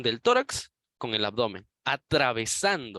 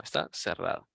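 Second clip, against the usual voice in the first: quiet repeat; 7 semitones lower, 5 dB quieter than usual. Shown as a demonstration. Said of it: "como ya habíamos mencionado".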